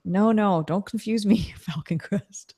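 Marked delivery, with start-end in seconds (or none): 1.6-2.5 whisper. Real, not acted. Joking aside whispered.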